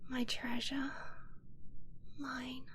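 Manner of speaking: soft, breathy whisper, lingering on the endearments; slow, adoring whisper to the one in your arms; tender whisper. Acted. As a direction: murmur it close as you hold him. Whispering vows while holding partner.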